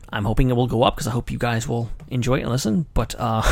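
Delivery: ordinary talk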